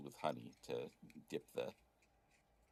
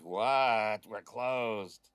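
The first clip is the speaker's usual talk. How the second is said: loud, irritated call down from above; grumpy innkeeper shouting down; annoyed shout from a window; 5 semitones higher, 11 dB louder, much slower than usual. Acted. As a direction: bark it out, woken and grumpy.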